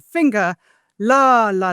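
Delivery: "finger, la, la" as one strong then two weak beats; dactyl rhythm. Shown as a demonstration. Scholar illustrating the dactyl by chanting syllables. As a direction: count out a dactyl. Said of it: "but dactylic".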